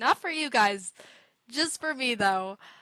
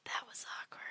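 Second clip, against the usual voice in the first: whisper; 14 dB quieter, much faster than usual. Real, not acted. Embarrassed aside about dog.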